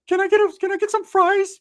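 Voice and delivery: high, pleading falsetto; Ricky's high voice. Retold or imitated. Ricky asking the narrator for fries in a high falsetto. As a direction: beg for fries sweetly.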